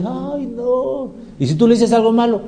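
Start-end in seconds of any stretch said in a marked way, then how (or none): 0.0-1.1 quejido agudo y suave de admiradora, en burla; mujer embelesada. Acted. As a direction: imita a una fan derretida. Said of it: "pero hay mujeres". (voz aguda de mujer enamorada, fingida)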